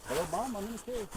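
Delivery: put-on high childlike voice; kid calling mom. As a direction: pitch way up like a little kid. Pretend phone call to mom.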